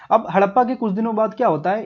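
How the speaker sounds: ordinary talk, nothing marked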